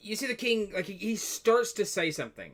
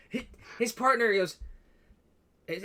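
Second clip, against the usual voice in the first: ordinary delivery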